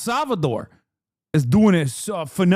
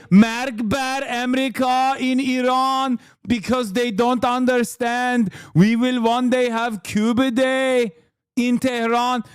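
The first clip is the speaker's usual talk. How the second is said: put-on lightly Persian-accented, higher; 5 semitones higher than usual. Mocking what Iranians say, light Persian accent. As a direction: keep the Persian accent going. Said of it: "I do a pretty good Persian".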